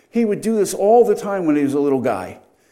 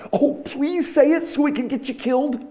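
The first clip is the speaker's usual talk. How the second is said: mock eager plea; 9 semitones higher than usual. Mocking the council's eager question.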